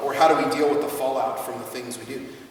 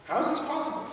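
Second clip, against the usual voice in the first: much higher incredulous question; mock amazement; 14 semitones higher, 3 dB quieter than usual. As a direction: act stunned by it. Mock bafflement at results.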